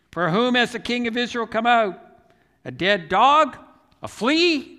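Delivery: loud, high rhetorical questions; shouting. Retold or imitated. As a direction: call out incredulous questions, rising on each. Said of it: "he started shouting to".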